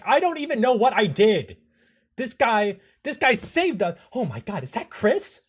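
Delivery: high, loud babble rising to a yell; panicked protest bursting into a shout; frantic outburst. Acted. Anderson under arrest spots Chris, frantic and shouting.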